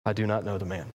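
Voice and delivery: grim, quiet oath dropped low; sworn denial. Acted. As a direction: Peter swearing he doesn't know Jesus, low and soft. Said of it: "invoked a curse on himself and began to swear".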